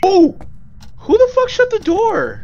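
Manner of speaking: shrieking high and loud in fright; jump-scare yell, pitch shooting up; startled scream. Real, not acted. Ghost slams door, startled.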